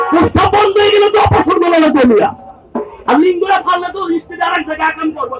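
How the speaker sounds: high, shouting sales pitch. Real, not acted. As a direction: yell the warning at the crowd. Street hawker warning the crowd.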